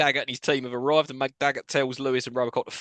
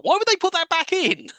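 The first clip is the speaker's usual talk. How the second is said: high-pitched incredulous question; exasperated disbelief; 13 semitones higher than usual. Real, not acted. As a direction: protest the senseless decision, pitch jumping up. Exasperated at the plot.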